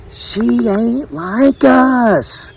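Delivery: high, extremely drawn-out words; scornful mama. A narrator's reading. Mama warning her son off the outsider, high slow scorn. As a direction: stretch each word in disapproval.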